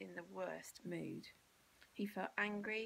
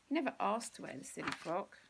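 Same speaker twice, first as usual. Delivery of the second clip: usual speaking voice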